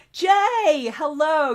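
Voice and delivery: high, loud happy hello; excited greeting. Real, not acted. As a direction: call out a cheerful welcome. Streamer greets arriving viewer Jay.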